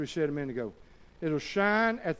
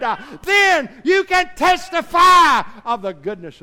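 loud, high, fervent preaching; preaching at full force; 13 semitones higher, 14 dB louder than usual. Acted. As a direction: shout it out from the pulpit. Preaching climax, shouting.

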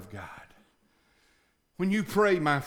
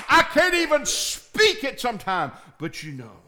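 higher, louder cry to God, then dropping to a hush on but you know; pleading prayer; 9 semitones higher, 8 dB louder than usual. Acted. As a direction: pour your heart out to God. Acted prayer crying out to God.

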